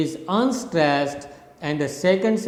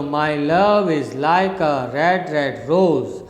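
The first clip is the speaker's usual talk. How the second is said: LOVE, LIKE, RED, ROSE swelling after light syllables; iambic rhythm. Shown as a demonstration. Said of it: "This is how the rhythm of that poem".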